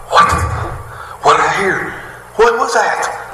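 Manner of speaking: put-on high, startled little-girl questions; astonished little girl. Preacher acting out deaf girl hearing sound, astonished. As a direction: imitate a child amazed by a first sound. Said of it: "the little girl that can't hear".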